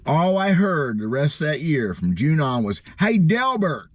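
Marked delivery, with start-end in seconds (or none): 3.0-3.9 imitating a greeting. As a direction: mimic the folks calling him Delbert. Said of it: "He calls me Delverts".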